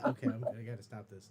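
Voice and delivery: ordinary talk, nothing marked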